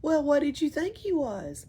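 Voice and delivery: ordinary delivery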